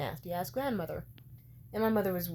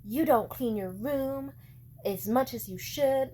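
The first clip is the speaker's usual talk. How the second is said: slow, mocking imitation of mom, a bit higher and louder; 5 semitones higher, 5 dB louder, slower than usual. Mocking mom nagging about the messy room, slow and snide. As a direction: drag it out like a snide mother. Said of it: "she tried to be like".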